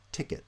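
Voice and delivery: "ticket" with the first syllable stressed. Shown as a demonstration. Lecturer giving an example noun, first-syllable stress on "ticket". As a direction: stress the front of "ticket", falling off after. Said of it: "tend to have initial stress".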